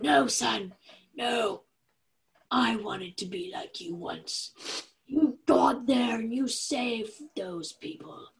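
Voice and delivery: hammed-up dying dad pleading with his son; dying old father. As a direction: play a failing father on his deathbed. Dying father on phone, acted.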